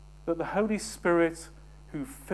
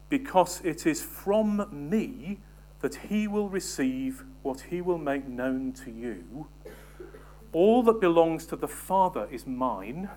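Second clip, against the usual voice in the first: weighty scripture reading; 2 semitones higher, 5 dB louder than usual. Acted. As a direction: lean into Jesus's words, deliberately. Preacher reads scripture aloud.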